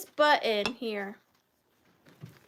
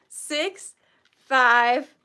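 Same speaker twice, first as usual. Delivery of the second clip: usual speaking voice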